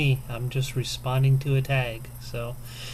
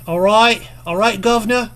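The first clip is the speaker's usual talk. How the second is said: higher, louder hammed-up British greeting; mock British accent; 8 semitones higher, 7 dB louder than usual. Vlogger warming up for a British-themed tag, mock British accent. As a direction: put on a hammy British accent, pitched up. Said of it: "attempts at a British accent".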